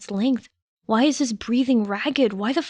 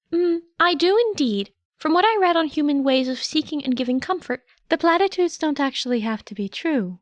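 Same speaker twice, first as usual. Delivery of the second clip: a kindly small creature soothing a panicked friend, pitched higher; lightly voiced gentle chimera, higher; 6 semitones higher than usual. A narrator's reading. Nezu talking a panicking Shota down, gentle higher voice.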